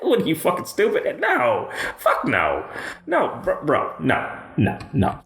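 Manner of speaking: high, laughing scoff; amused mocking no no no; laughing disbelief. Real, not acted. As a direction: laugh it off as ridiculous.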